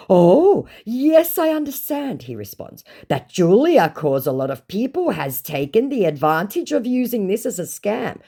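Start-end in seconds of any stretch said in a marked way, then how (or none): 0.1-2.2 high, loud mock understanding; hammed-up gushing agreement; put-on "oh yes", pitched way up; scammer voice. Acts scammer's response. 3.1-8.2 high, hammed-up explanation; mock an over-earnest scammer; put-on pleading account, pitched up. Acts scammer's response.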